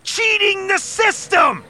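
high, slow, outraged shout; shouted accusation. Acted. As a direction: bellow the charge slowly. Cop accuses the kids.

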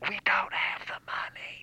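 hushed aside; whispering. Acted. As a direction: whisper it so he can't hear. Secret aside from contestant.

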